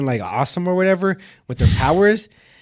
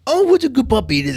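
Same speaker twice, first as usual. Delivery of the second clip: high-pitched cooing at a dog; playful puppy talk; 8 semitones higher than usual. Acted. Party girl to a dog, playful high puppy talk. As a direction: gush over a puppy in a high voice.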